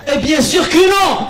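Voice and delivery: high, drawn-out loud answer; mock fatherly outburst. Father answering son about loving mother, mock shout. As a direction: shout it as a hammed-up exasperated father.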